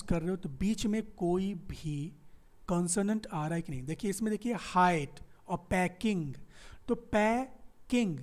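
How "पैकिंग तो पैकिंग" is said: पैकिंग split slowly into पै and किंग; syllable by syllable. Shown as a demonstration. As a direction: break पैकिंग at the क so both vowels stand apart. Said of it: "ए और ई के बीच में क आ गया".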